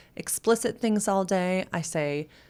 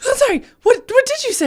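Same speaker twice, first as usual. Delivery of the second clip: much higher and louder, cracking with laughter; laughing disbelief; 9 dB louder, faster than usual. Retold or imitated. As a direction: ask it while barely holding in laughter. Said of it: "And I was like".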